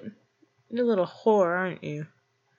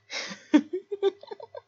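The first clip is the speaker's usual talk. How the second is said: high-pitched rising giggle; high mocking giggle; 14 semitones higher than usual. Laughing at the listener's mouth with a high giggle.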